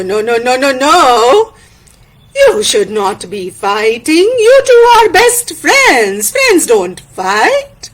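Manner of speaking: slow, drawn-out village elder voice; ponderous, admonishing elder; Tayu, disappointed elder. Acted. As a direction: scold and then judge with deliberate weight.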